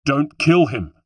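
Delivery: loud, high urgent roar; roared command. A narrator's reading. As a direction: roar it out to stop him firing. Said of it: "I roared".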